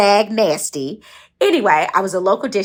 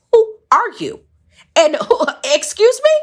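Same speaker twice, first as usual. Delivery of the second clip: much higher and louder, offended; indignant disbelief; 11 semitones higher, 4 dB louder than usual. Retold or imitated. Girl confronting a scolding stranger, high indignant. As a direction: rise up in outraged disbelief with a laugh. Retorting to the man in thought.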